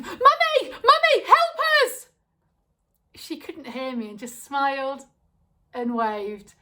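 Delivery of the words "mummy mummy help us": high, loud panicked scream for mum; terrified child screaming high and loud; screaming for help. Retold or imitated. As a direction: shriek for mum like you're falling. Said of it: "my mum screaming".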